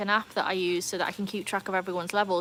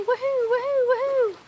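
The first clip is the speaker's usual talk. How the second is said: high, louder woohoos; excited cheer; 6 dB louder than usual. Real, not acted. Streamer celebrating Daisy levelling up, high cheering woohoos. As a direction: cheer like a party horn.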